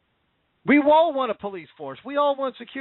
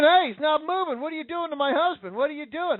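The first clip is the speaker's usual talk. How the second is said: higher, mock panicked wife's cry; frantic wife pleading with the police, pitched up; wife screaming; 6 semitones higher than usual. Wife begging cops to stop, higher panicked cry.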